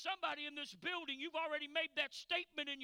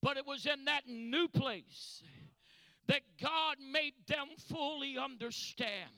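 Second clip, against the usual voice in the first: ordinary talk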